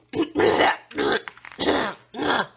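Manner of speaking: loud hacking monster cough; horrible monster cough. Acted. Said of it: "It coughed horribly".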